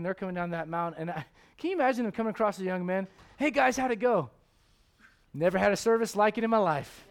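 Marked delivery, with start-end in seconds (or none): 3.4-7.1 excited report. Pretend. Voicing men coming down mountain.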